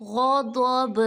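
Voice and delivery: غضب ending in a bouncing jolt on ب; qalqalah on ba. Shown as a demonstration. Teacher demonstrating qalqalah in غضب. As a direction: snap the final ب of غضب with an echo. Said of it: "جھٹکے کی آواز نکالیں گے با میں".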